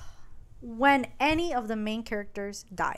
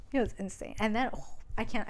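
ordinary delivery

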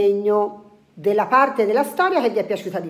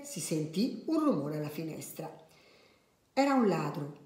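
soft storyteller with lively character lines; children's story narration; 6 dB quieter than usual. A narrator's reading. Adult reading a funny tale to children, animated storytelling. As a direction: read it gently, acting out each line.

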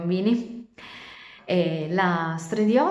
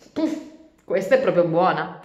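mocking laugh; 5 dB louder than usual. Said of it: "rispose Chicco continuando a ridere".

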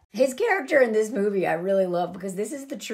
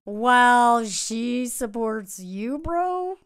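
mock Sheppy bro; much slower than usual. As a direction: put on a slow, dopey dude. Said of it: "old Sheppy boy's all like".

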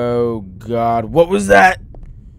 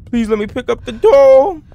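pleading; 10 semitones higher, 8 dB louder than usual. Real, not acted. Begging the game.

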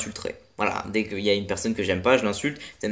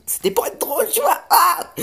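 squeaky high voice; 5 dB louder than usual. Pretend. Joking squeal after failed burp.

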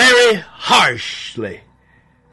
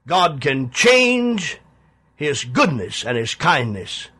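usual speaking voice

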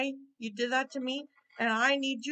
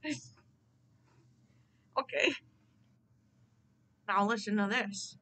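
usual speaking voice

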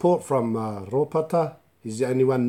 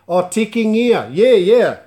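excited exclamation; 9 semitones higher, 11 dB louder than usual. Real, not acted. Host reacting to a viewer comment.

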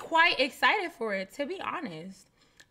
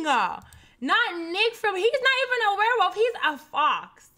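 excited disbelief; 6 semitones higher, 6 dB louder than usual. Real, not acted. Laughing disbelief at fox werewolf.

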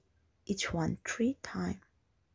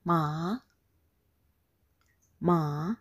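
"ma" starting low and sliding up; rising tone; 3 semitones lower than usual. Shown as a demonstration. Teacher models last tone on "ma", rising. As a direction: lift "ma" upward like a question. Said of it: "for the last tone is".

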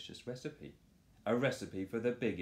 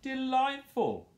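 much higher, louder, slow moan; 8 dB louder, slower than usual. Acted. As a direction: moan in greedy bliss. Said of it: "moaned Boris".

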